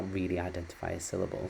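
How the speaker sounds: normal talking voice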